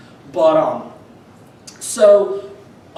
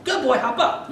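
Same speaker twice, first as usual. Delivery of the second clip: encouraging dog command; 8 semitones higher than usual. Retold or imitated. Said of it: "and then I say".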